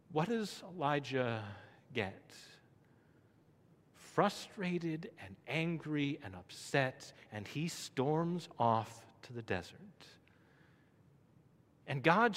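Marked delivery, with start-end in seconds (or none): none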